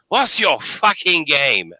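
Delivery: a sudden furious actor breaking character; angry outburst. Retold or imitated. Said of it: "just turned around".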